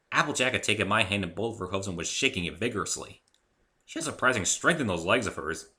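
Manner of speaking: usual speaking voice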